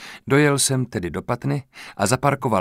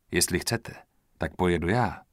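softer, lower, gentle offer; gently; 4 semitones lower, 5 dB quieter than usual. Acted. Offering to drive for shaken Linda, soft gentle voice. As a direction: ease her along kindly. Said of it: "řekl jsem mírně".

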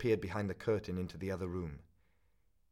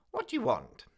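high, louder suspicious demand; old pawnbroker woman glaring at the young man, a high louder challenge; sharp suspicious old woman; 6 dB louder than usual. Acted. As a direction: snap at the visitor like a wary old crone. Old woman confronts visitor.

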